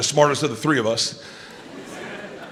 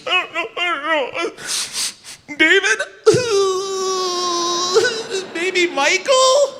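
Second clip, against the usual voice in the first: put-on high-pitched tearful child voice; panicked little boy; 13 semitones higher than usual. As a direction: whimper the guesses like a lost kid. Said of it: "he's still panicked, but he's settled".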